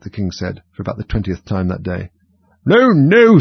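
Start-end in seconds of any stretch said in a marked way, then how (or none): 2.6-3.4 very high, loud, drawn-out refusal; sweeping, shouted veto; imperious outburst. A narrator's reading. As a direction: overrule everyone like a tyrant queen. Queen loudly overrules the King.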